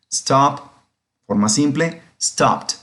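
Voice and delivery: usual speaking voice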